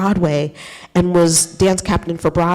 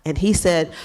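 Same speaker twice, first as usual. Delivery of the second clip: ordinary talk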